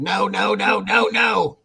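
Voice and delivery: high-pitched mock tantrum; mock scream. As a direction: imitate her screaming in a high voice.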